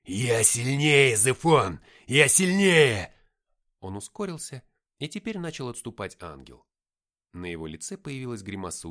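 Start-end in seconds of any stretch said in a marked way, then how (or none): 0.0-3.1 very loud, slow effortful roar; shouted with great effort, vowels stretched; strained shout. A narrator's reading. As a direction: force each word out in a drawn-out yell. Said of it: "с трудом сказанные им слова".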